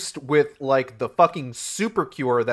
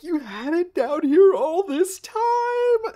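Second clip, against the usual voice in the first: much higher, slow, silly mocking voice; mock Dane DeHaan; slower than usual. Mocking Dane DeHaan finding the cure, high and slow. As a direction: stretch it out in a goofy high voice. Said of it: "Dane DeHaan's just like".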